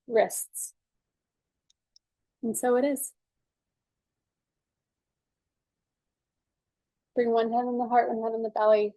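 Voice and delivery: calm, measured guided-session voice; guided tapping. Acted. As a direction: talk them gently through each tapping point.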